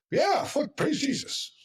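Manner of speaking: high-pitched mock churchy enthusiasm; squeaky-high put-on praise; fake praise. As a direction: sarcastically gush with phony joy. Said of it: "fake, like".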